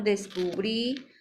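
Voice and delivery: "descubrí" drawn out, stressed on the last syllable; "descubrí" slow with a rising, accented ending; stress on final syllable. Shown as a demonstration. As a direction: lean on the end of "descubrí". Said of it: "Todas estas llevan acento al final".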